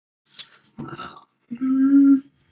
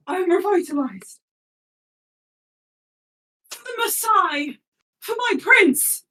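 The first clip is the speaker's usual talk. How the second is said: Kev rallying; 9 semitones higher than usual. Acted. Kev rallies for his prince.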